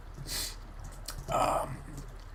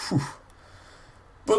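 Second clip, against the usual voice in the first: normal talking voice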